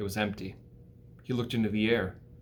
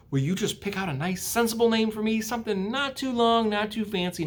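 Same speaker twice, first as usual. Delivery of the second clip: much higher tiny pledge; small spider voice; 10 semitones higher than usual. Acted. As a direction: chirp it like a little spider. Third spider speaks.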